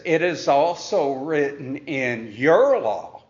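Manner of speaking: "your" leaned on, falling after it; stress on your. Shown as a demonstration. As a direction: hit "your" in "your law". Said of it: "notice that emphasis there on the word".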